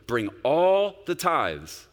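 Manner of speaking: slow and loud, every word weighted; emphatic command. Shown as a demonstration. Pastor reading God's command to the church, slow and emphatic. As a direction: Bring all the tithes, hammered out slowly. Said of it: "I'm gonna read with emphasis".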